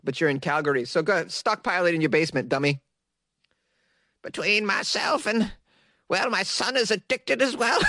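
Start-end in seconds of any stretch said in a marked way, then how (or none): 4.2-7.8 put-on high old lady voice; Carrie, ancient woman. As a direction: confide it as a very old woman, pitched up. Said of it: "That's how she talks".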